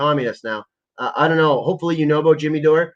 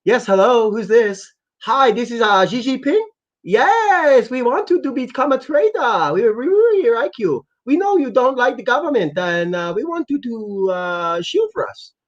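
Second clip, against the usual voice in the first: higher put-on voice of a Chinese leader on the phone; mock Xi Jinping; 7 semitones higher, slower than usual. Streamer's fake phone call from the Chinese leader, higher put-on voice. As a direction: play a smooth recruiter calling a streamer. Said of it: "I'll make a fake phone call".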